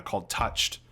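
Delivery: ordinary talk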